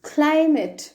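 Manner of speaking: క్లైమేట్ with a short ending, "KLY-mit"; correct pronunciation. Shown as a demonstration. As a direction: end క్లైమేట్ on a weak "-mit". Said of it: "ఏమనాలి?".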